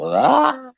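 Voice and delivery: sudden high yelp of alarm, voice shooting up; a short mock shriek of fright; panicked yelp. Telling the story, he yelps at the BBC phone call, high and startled.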